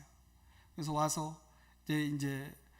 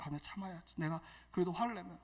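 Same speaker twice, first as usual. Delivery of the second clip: much softer, muted self-talk; holding anger back; 7 dB quieter, faster than usual. Retold or imitated. Evangelist talking himself out of anger, muted restraint. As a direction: act out swallowing the anger under your voice. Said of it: "억눌러서 참아야 했어요".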